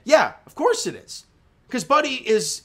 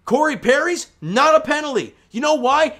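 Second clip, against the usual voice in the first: ordinary talk, nothing marked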